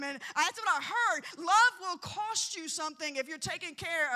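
loud, driving sermon delivery; full-voiced preacher; preaching. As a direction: preach it to the congregation.

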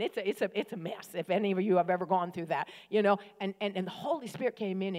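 ordinary talk, nothing marked

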